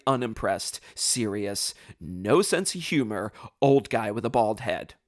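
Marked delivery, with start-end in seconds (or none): none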